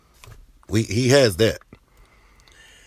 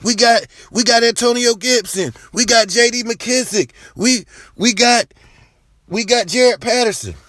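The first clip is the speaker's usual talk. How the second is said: mocking fans; 11 semitones higher than usual. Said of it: "I know you guys like".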